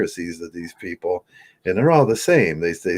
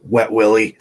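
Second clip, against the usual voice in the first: sudden loud punchline after the whisper; spring the joke out loud; 4 semitones higher, 6 dB louder than usual. Pretend. Dying man springs a prank on his family. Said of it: "And then".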